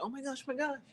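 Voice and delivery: high, soft starstruck gushing; mock fan gush. Host imagining shoppers recognizing her, high soft gush. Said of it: "everyone's like".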